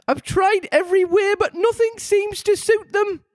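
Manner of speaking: high falsetto lament; plaintive comic pigeon, mock sob; sobbing pigeon. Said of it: "added with a kind of sob".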